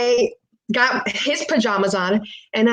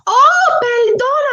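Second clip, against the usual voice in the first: very high, loud mock apology; startled apologising woman; 5 dB louder, slower than usual. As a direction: mimic a shocked woman's shrieky apology. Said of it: "and she goes".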